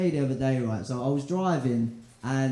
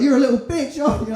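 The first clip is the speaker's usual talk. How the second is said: high teasing voice, laughing; mock taunt; 9 semitones higher, 10 dB louder, faster than usual. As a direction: tease with a grin in the voice. Girl teasing in the gym.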